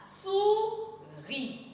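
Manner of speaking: "souris" as high, held "sou" then separate "ri"; syllable by syllable. Shown as a demonstration. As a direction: sound out "souris" in two beats. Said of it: "on le lit syllabe par syllabe".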